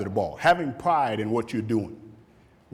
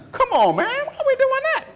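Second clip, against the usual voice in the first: high, laughing complaint; mock protest to the coach; whining player; 3 dB louder than usual.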